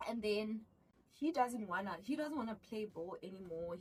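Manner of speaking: normal talking voice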